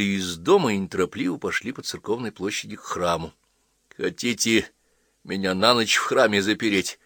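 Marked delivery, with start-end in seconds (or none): none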